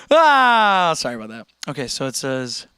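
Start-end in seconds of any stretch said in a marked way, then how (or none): 0.1-0.9 loud, high drawn-out "ah" sliding down; sudden loud wail; loud cry. Real, not acted. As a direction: let out a long falling yell. Clowning on stream, then apologizes.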